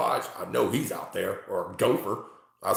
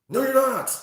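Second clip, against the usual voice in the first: loud retort; 8 dB louder than usual. Real, not acted. Scolding chat troll loudly.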